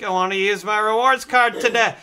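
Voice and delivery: hammed-up insistent shouting; exasperated customer. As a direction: repeat it loudly for someone not hearing. Retold exchange, repeats it after "What?.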